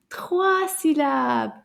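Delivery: ordinary talk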